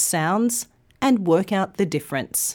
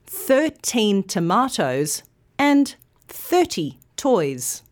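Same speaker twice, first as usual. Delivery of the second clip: put-on thirteen with teen long, thirty with thir long; contrast stress; slower than usual. Shown as a demonstration. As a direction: make thirteen and thirty clearly different. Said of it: "say it with me".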